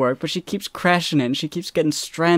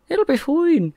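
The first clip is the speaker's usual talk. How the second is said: higher, lightly put-on character voice; 13 semitones higher than usual. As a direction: echo the character's line up high. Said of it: "Like, "Well, I'm not sure".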